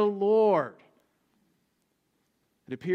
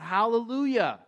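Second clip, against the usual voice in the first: raised hallelujah, a touch of a shout; mild shout; 4 semitones higher than usual. Retold or imitated. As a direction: lift it like the angels joining in. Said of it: "as they too shout".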